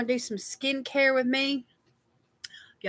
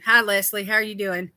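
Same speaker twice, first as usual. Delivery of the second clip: ordinary delivery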